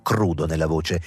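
usual speaking voice

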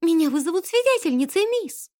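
high, frightened maid's cry; trembling exclamation. A narrator's reading. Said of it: "воскликнула она вся дрожа". (испуганное восклицание)

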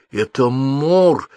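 high, very slow, voiced dread; frightened whisper. A narrator's reading. Девочка узнала Мора, frightened whisper. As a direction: draw the words out in fright. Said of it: "испуганно зашептала девочка".

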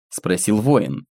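ordinary delivery

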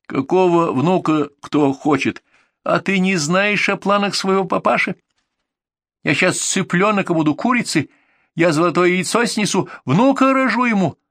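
high-pitched indignant tirade; angry rant. Acted. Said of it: "Я орала то на английском". (возмущённая тирада на повышенных тонах)

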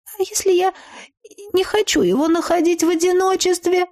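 put-on high-pitched, reluctant girl's voice; timid friend. A narrator's reading. Timid friend objects to splitting up. (высоким, робким голосом подруги)

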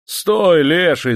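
громкий высокий окрик; кричит на коня во весь голос; крик. Acted. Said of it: "закричал он". (конюх кричит на резвого скакуна, громкий крик)

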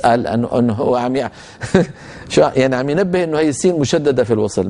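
usual speaking voice

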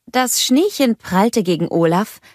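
ordinary delivery

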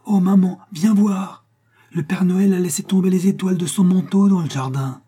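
put-on high, loud little boy's voice full of wonder; excited child call. A narrator's reading. Little boy calling his mother to see the starry garden, high and thrilled. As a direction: call mummy over in delight.

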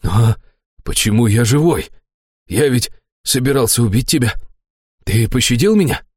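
astonished, voiced character questions; wondering, incredulous fat man; surprised. A narrator's reading. Fat man to the one who spared him, astonished. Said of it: "удивлённо проговорил толстяк".

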